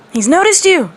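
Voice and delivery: high loud panicked shriek. A narrator's reading. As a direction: shriek it in alarm. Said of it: "the Kyuubi shrieked".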